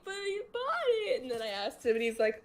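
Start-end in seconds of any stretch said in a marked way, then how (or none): 0.0-1.2 much higher, mock-indignant quote; high-pitched protest. Retelling being banned over a gift, high-pitched protest. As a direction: squeak out a retold protest.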